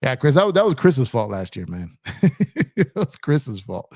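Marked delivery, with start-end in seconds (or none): 2.0-2.7 burst of laughter. Real, not acted. Teasing Chris about last year. 2.8-3.9 laughing. Real, not acted. Teasing Chris about last year.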